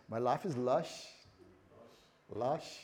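lightly attempted Bristol accent; Bristolian accent. Preacher echoing the local slang, light Bristolian accent. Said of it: "give me a Bristolian accent".

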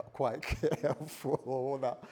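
words broken up by laughter; amused at his own mobster bit; laughing. Real, not acted. Amused by own mafia impression.

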